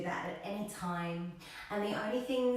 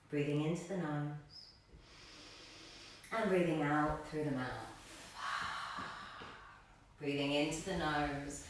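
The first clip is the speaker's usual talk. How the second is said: calm, slightly lowered meditation voice with long pauses; guided meditation; 3 semitones lower than usual. Real, not acted. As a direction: guide them gently into stillness, leaving space between phrases. Guides seated breathing meditation.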